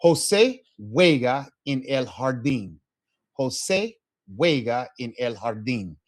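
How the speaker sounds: "José juega en el jardín" with the j as a breathy h; model sentence. Shown as a demonstration. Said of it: "I'll say it first".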